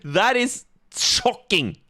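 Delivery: slow, high, put-on voice of fake astonishment; mock shock. Host teasing the guest about accents, mock-shocked and slow. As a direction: drag it out in sarcastic disbelief. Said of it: "Kappa, I'm just joking".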